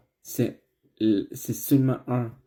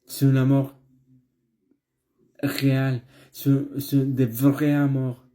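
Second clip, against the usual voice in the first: building preaching; 3 semitones higher, 4 dB louder than usual. Acted. Preaching builds toward the close.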